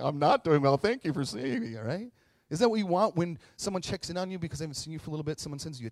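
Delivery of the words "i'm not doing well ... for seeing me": loud, blubbering thanks, hammed up; mock crying. Struggling speaker thanking someone who noticed, mock crying. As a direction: burst into pretend tears. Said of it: "I just start crying".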